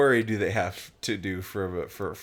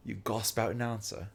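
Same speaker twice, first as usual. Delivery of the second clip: British-accented demonstration, gasp as "gosp"; 4 dB quieter than usual. Shown as a demonstration. Friend demonstrates the disputed word in a British accent. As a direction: say it British, with a long "ah". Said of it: "with an, a British accent".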